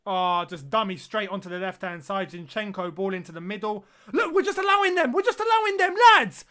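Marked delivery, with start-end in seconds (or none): 4.1-6.4 loud and much higher; real exasperated yelling at the team; frustrated shout. Real, not acted. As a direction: shout at the screen in despair. Frustrated fan at his team's defending.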